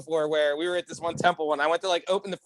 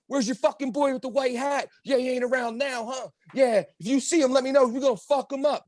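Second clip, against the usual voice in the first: high-pitched mock threat; imitated yelling; 7 semitones higher than usual. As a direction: pitch it up as a blustering tough guy. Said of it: "and he's yelling".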